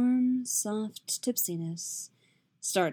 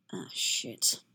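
breathy, slurred curse under the breath; soft drunken curse; drunken mumble; 5 dB quieter than usual. A narrator's reading. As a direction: let the drink thicken it. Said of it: "alcohol thick in his voice".

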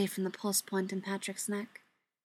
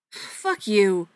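louder, half-slurred snarl; 7 dB louder than usual. Acted. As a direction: spit it out sedated. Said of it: "Patrick snarled".

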